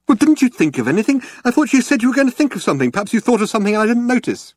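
high, fast, anxious gabble; frantic rapid-fire pleading; panicky questioning. A narrator's reading. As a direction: press desperately for any hope. Arthur panicking, trapped in airlock.

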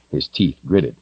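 ordinary delivery

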